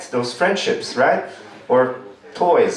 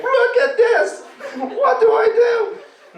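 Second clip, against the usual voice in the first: very high, slow, laughing panic; panicked kid; slower than usual. Retold or imitated. Kid showing mom the limp arm, high panicked wail. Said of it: "They're like".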